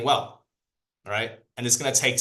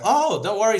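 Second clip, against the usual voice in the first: reassuring mom; 9 semitones higher, 4 dB louder than usual. Acted. Mom reassures her son.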